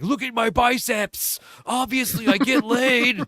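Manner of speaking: high, loud, slow mock bragging; macho brag voice. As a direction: ham up a cocky brag. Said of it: "saying, like".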